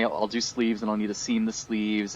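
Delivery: ordinary talk, nothing marked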